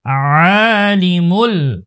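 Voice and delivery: slow আলিমুল, its first sound squeezed in the throat; throat-pressed ayn. Shown as a demonstration. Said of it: "আইনের উচ্চারণটা গলায় চাপ দিব". (আলিমুল-এর শুরুতে গলায় চাপ দিয়ে টান)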